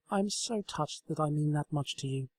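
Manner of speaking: affectionate pony voice; tender Twilight. A narrator's reading. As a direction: speak fondly to the table. Twilight touched.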